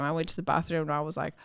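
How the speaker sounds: ordinary talk, nothing marked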